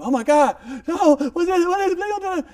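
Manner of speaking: high, loud panicked cries; hammed-up terror at the thunder; scared golfer. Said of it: "The golfer was scared".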